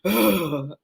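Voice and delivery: long, breathy mock sigh; imitated exasperated sigh. Irritated caller answers a woman, imitated heavy sigh. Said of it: "he just literally, like, sighed".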